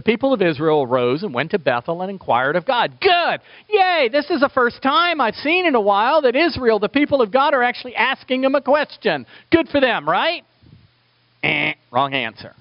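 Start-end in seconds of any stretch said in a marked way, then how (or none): 3.0-10.4 much higher, bright cheer of Good and Yay; mock cheering. Pastor cheering Israel for finally asking God, high and excited. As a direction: cheer them on like a delighted fan.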